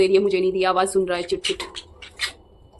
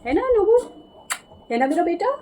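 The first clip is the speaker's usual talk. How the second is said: high sing-song pet talk; 8 semitones higher than usual. Real, not acted. Owner cooing to her bird, high baby talk.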